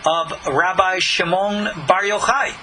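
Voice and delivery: ordinary talk, nothing marked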